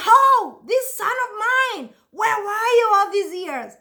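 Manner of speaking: high, gushing mock-proud dad; proud father. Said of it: "He's like".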